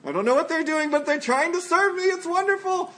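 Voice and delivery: high, tender delighted praise; delighted Father. Retold or imitated. Father in heaven delighting in his children, high tender praise. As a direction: beam with delight at a child's gift. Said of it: "our Father sits in the heavens and goes".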